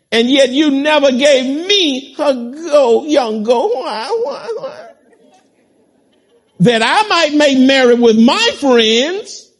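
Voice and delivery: preacher voicing the jealous brother to his father; whining elder brother. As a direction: plead like a jealous son wronged.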